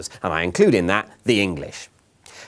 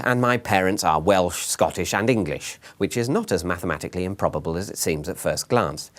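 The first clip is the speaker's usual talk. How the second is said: usual speaking voice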